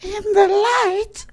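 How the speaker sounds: very high, loud, slow channeled voice; mock channeling in piercing high pitch; spirit guide voice. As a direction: intone it like a squeaky otherworldly entity. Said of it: "gotta be talking like this".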